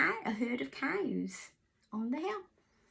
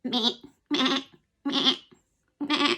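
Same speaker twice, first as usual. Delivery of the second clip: goat bleat; 2 semitones higher, 5 dB louder than usual. Shown as a demonstration. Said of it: "I've actually got a goat".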